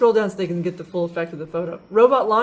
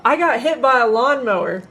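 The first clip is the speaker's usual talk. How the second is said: louder, higher mock yell of a little boy; retold boy's yell; 4 semitones higher, 5 dB louder than usual. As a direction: imitate a kid blurting it out to a crowd. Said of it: "This little boy yells out".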